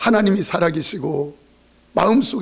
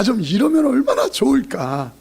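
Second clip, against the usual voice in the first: ordinary talk, nothing marked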